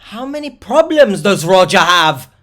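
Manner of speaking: loud, high-pitched incredulous question; exasperated outburst. Real, not acted. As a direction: cut in loudly, totally exasperated. Interrupts the story in disbelief.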